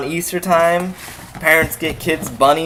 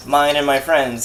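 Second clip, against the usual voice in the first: ordinary talk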